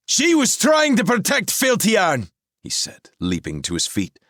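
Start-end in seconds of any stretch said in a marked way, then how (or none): 0.1-2.3 high, loud defiant protest; defiant youth. A narrator's reading. Elan protests to Merlin, loud and high. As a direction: defend her fiercely.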